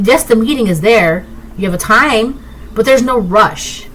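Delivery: ordinary talk, nothing marked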